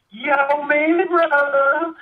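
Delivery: exaggerated Southern accent, high and slow. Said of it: "thick Southern accent".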